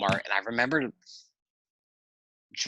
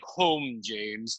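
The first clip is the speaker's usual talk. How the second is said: lightly imitated order to a chauffeur; a hint of the grand boss, falling away at the end; mock command to the driver; Big Daddy's deep voice; 4 dB quieter than usual. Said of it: "in that deep voice of his".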